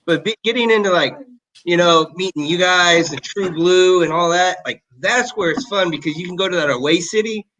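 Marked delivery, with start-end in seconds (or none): none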